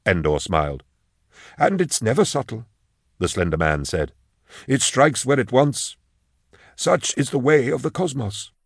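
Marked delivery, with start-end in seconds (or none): 1.6-2.7 measured, higher-pitched funeral director's voice; formal lilting undertaker, reading down the list; solemn obliging undertaker. Acted. Slender undertaker on death. 4.7-8.5 ceremonious and unhurried, pitched up; solemn philosophising undertaker. Acted. Slender undertaker philosophises on death.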